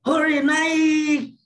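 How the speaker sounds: high, loud hooray with NAIK held long; overjoyed cheer. Shown as a demonstration. Lecturer acts out someone cheering a rising score, overjoyed high shout. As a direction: shout it like celebrating a big win. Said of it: "dengan gerakan melompat atau kegirangan".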